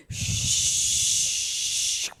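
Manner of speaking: long whooshing wind, voiceless; wind noise. Acted. Preacher mimics wind over the lake, long whoosh. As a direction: imitate a rising gust with a long shush. Imitates wind starting to blow.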